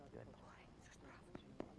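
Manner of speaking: breathy soft praise; whispered praise. Real, not acted. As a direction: whisper it to the dog, no voice. Trainer praises dog softly.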